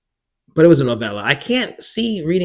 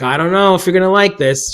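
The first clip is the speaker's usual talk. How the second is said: lightly voiced hesitant mom, higher and louder; imitating mother; 6 semitones higher, 5 dB louder than usual. Mom offers daughter an unwanted birthday gift, tentative, imitated. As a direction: raise the voice a little as a retold mom.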